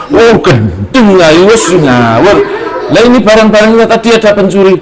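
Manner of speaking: high, exasperated wife scolding; a wife blowing up at her husband; furious wife. Acted. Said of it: "istrinya tambah ngamuk".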